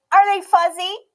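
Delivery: put-on high, bright little girl voice, loud and proud; a stubborn little girl declaring herself; Emmy's child voice. A narrator's reading. Emmy asking.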